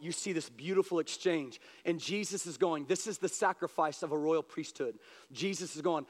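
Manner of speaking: usual speaking voice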